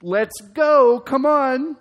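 loud, high, urging on a slow car; impatient driver. Retold or imitated. Preacher acting himself stuck behind a slow driver, loud and impatient.

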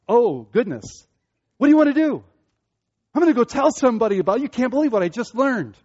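acted-out thrill of learning something; excited discovery. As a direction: blurt it like breathless big news. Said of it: "You're excited by that".

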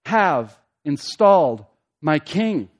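loud, deliberate decree with weighty pauses; dreadful declaration. Acted. God declaring his chosen king to the nations, dreadful declaration. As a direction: hammer it out as an almighty pronouncement. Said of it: "here's the dreadful declaration".

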